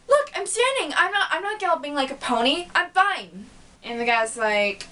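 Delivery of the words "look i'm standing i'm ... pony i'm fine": high, quick, overeager insistence; insisting Twilight. Retold or imitated. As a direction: protest a bit too brightly. Twilight insists she's walking fine.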